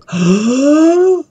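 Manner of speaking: sharp high gasp of delight; excited gasp. Real, not acted. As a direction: gasp at the sight of treasure. Finds diamonds while mining.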